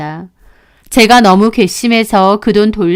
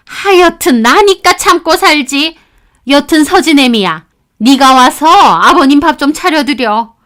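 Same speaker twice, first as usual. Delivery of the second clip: put-on higher, insistent older woman; fussy mother-in-law; 7 semitones higher, 5 dB louder than usual. Mother-in-law ordering daughter-in-law to cook, insistent. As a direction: lay out her grievance and demand.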